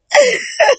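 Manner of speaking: high amused cackle; laugh. Real, not acted. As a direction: crack up at your own skit. Laughs at own acted exchange.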